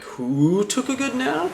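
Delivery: high, slow, mocking falsetto taunt; sing-song the taunt way up high; mocking Alvida voice. Captain taunting the cabin boy, in a high mocking falsetto. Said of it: "being like".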